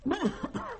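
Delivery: choking cough on strong liquor; spluttering cough. Acted. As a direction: cough hard after a big swig. Cookie chokes on strong bourbon.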